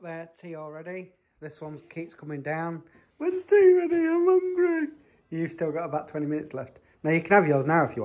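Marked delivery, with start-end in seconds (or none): 3.2-4.9 put-on much higher, childlike plea; imitating a child, pitched high; mimicking hungry kid. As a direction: play the kid nagging for dinner.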